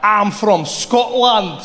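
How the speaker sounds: slow, drawn-out put-on Scottish accent. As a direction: insist on being Scottish in a Scottish accent. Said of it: "Scottish accent".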